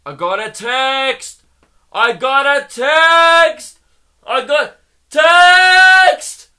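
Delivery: drawn-out yell building to a high scream; mock screaming. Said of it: "they just start screaming".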